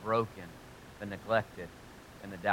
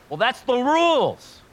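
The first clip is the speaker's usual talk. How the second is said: much higher, louder, slow prissy voice; mock religious rule-keeper; 14 semitones higher, 15 dB louder, slower than usual. Pastor mocking a rules-bound churchgoer, high and slow. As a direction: send up a stiff rule-keeper. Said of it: "We struggle with, like".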